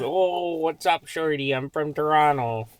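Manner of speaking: slow, hammed-up Toronto accent; mock Toronto slang. Host mocking outsiders' take on Toronto slang. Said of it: "Everybody goes".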